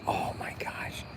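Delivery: awed whisper. Real, not acted. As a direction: whisper it as if the food were in front of you. Dreaming of soul food.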